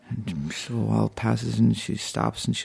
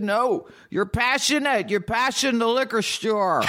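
emphatic insistence; 10 semitones higher than usual. Acted. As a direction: spell it out insistently, pitched right up. A little more insistent this time.

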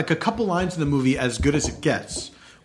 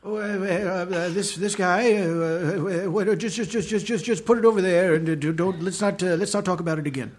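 put-on stammering, dithering old man; old man voice, hesitant and muddled; doddering old man. Shown as a demonstration. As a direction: dodder through it, repeating and stumbling. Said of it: "What's your old man voice?".

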